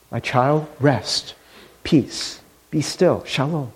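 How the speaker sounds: whisper-soft calming words; gentle divine voice. Acted. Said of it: "he hears that voice".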